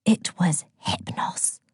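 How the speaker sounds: slow secret whisper. Acted. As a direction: let the name slip out softly. Ossa reveals secret.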